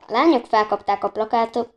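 ordinary delivery

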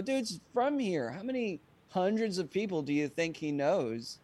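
mock indignant protest; incredulous retort. Mock-incredulous reply to management.

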